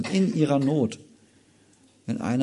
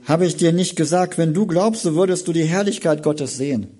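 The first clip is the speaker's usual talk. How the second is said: raised, louder proclamation of glory; Jesus declaring; 6 semitones higher, 6 dB louder than usual. Acted. Jesus to Martha, a raised, ringing promise. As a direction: announce it with conviction, higher.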